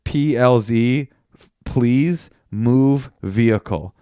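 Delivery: slow, spaced-out official wording; mock cop note. Note faking a cop to a van owner, slow and official. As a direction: read it word by word like a terse police order. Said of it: "what I assumed was, like, cop writing".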